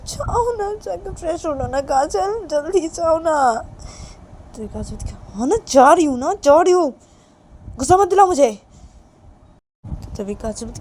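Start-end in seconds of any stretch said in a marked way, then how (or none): 0.1-3.4 high, loud urging; insistent pleading. Acted. Reshma presses Kajal to go freshen up, insistent. 5.3-8.5 high, very loud exasperated retort; irritated. Acted. Kajal warns Reshma not to anger her, irritated. Kajal snaps back at nagging.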